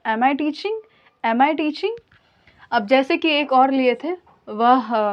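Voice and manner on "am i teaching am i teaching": "am I teaching" lifting at the end; "am I teaching" as a model question, rising on "teaching"; question intonation. Shown as a demonstration. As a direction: ask "am I teaching" with a clear final rise. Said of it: "बोलना भी है question की तरह".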